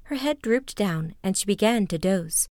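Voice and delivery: usual speaking voice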